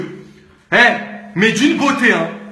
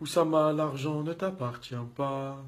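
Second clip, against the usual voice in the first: solemn whisper; 7 semitones lower, 13 dB quieter than usual. Acted. Solemn quiet aside.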